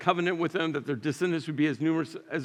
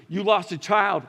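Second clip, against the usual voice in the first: ordinary delivery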